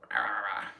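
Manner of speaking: low throaty attempt at a duck noise; failed duck voice. Shown as a demonstration. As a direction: try a duck voice and fail badly. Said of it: "I do not have a good duck voice".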